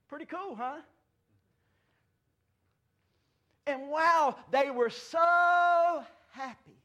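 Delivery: building from high to a loud, slow cry; preaching at full force. Acted. Pastor exulting to his church, building to a loud cry. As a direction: lift it into a joyful shout.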